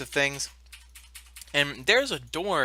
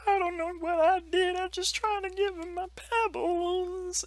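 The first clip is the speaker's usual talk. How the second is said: very high, sobbing giant; crying giant voice. Acted. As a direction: whimper it like a big crying child. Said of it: "he's like".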